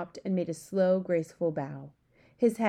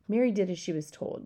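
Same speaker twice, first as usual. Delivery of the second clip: plain reading voice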